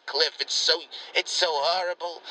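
normal talking voice

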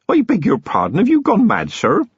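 high, startled disbelief; shocked outburst. A narrator's reading. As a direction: gasp out a shocked protest. Feeney shocked at Vimes' offer.

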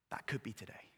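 hushed solemn warning; whispered. Real, not acted. Preacher warning the congregation, whispered and solemn. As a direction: lean in and whisper it.

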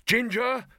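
high, loud call into a cavern; booming call. A narrator's reading. Man calling to Ginger across the cavern, booming. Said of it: "His voice boomed back at him".

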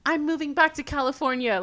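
high, hammed-up tearful vow; dramatic crying. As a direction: play the drama queen in tears. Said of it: "the most dramatic thing ever".

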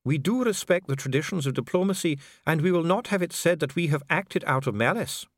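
a bureaucrat soothing a prisoner; calm official Dee. A narrator's reading. Dee answering imprisoned Vimes, coolly polite. Dee explains house arrest.

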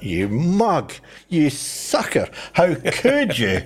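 mock dismay. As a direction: imitate your appalled younger self scolding a friend. Retold scornful reaction to friend's conversion.

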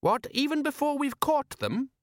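very high, baffled question; incredulous son piping up; puzzled son. Acted. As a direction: query Dad's logic in a high voice. Son baffled by father's claim.

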